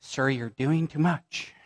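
a hint of a calm nurse; nurse's gentle warning. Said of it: "the nurse looks at me and goes".

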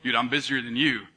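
low, cool boast; prideful one-upping. Acted. Friend opening a busyness contest, low prideful boast. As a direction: lay down a low-pitched boast. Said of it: "it becomes like this pride thing".

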